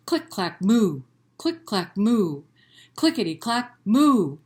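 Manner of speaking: rhythmic click clack with a big loud moo; read-aloud refrain; typing cow refrain. Acted. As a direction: bounce the clicks, belt the moo.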